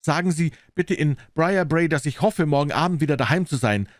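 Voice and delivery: high-pitched, lightly called message; calling out. A narrator's reading. Client calls from the departing train, lightly calling out. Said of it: "rief Phelps".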